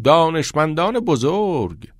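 high, slow, stretched-out words; mock awe. A narrator's reading. Boy teases friend about his hosts, mock-impressed. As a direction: draw it out grandly, teasing.

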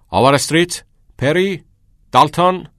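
high, loud barked surnames; shouted roll call. A narrator's reading. Old teacher summoning boys from the doorway, shouting. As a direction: shout each name out separately, high and loud. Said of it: "دکتر هگر فریاد زد".

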